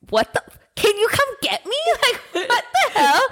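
high-pitched mock outrage; indignant. Locked-out woman pleading to be picked up, high indignant retelling. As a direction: whine it in exaggerated, high disbelief. Retold indignant demand for a ride.

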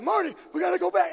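high, loud, fast frantic impression; urgent cry in a mad-scientist voice; Doc Brown. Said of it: "Doc from, from Back to the Future".

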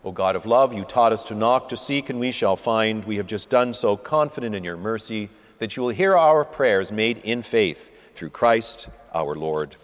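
steady liturgical reading, each petition falling to its close; intercession prayer. Acted. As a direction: lead the congregation's petitions calmly. Leads prayers of the faithful.

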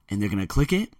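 usual speaking voice

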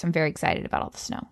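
deadpan fake excitement, fast and soft; sarcastic excited voice. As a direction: say "excited" while sounding anything but. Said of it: "this is my excited voice".

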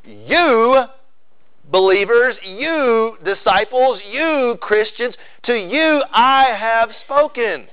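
each "you" lifted high and drawn out slowly; emphatic you. Shown as a demonstration. Preacher to his flock, stressing "you" high and slow. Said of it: "the "you" is emphatic there".

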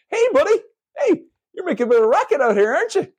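high-pitched, put-on authority voice; mock orderly. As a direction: call out high and hurried, hamming it up.

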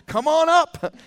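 high, loud host's call; big excited call upward; game-show summons. Acted. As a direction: shout the invitation like a game-show host. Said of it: "Just the opposite of Bob Barker".

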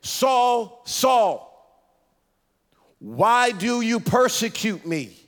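high, ringing call of Saul's name, slowing at the end; voice from heaven. A narrator's reading. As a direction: call down from heaven, drawn out and commanding. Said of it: "heard a voice say to him".

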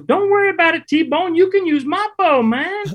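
very high surfer-dude voice, laid back; high-pitched surfer dude. Retold or imitated. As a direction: squeak it out like a mellow surfer guide. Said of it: "he's got a real high-pitched voice".

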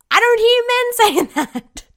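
loud, very high, through laughter; laughing exclamation. Real, not acted. Pointing out men never deflect praise, loud and laughing. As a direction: crack up while protesting, voice shooting up.

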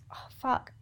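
whispered curse and a hushed teary laugh; choked up. Real, not acted. Choking up while recalling the proposal trip. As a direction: voice gives out into a breathy laugh. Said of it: "Fuck, why am I getting so emotional?".

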